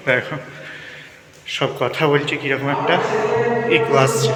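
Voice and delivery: talking in a big echoing hall. Real, not acted. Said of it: "ইকো হচ্ছে".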